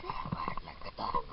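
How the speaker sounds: breathless whisper. Real, not acted. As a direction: gasp it out, barely voiced. Breathless aside while running.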